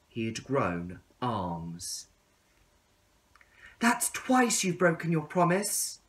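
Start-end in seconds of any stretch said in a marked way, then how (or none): 3.8-6.0 higher, wounded cry; crying out in hurt. Acted. Caterpillar crying out at the tadpole, higher and hurt. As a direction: call out the broken promise. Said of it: "cried the caterpillar".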